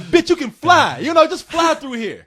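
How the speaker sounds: high, loud exasperated worker; annoyed cashier. Retold or imitated. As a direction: scold him like a fed-up cashier. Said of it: "Even the guy Taco Bell's like".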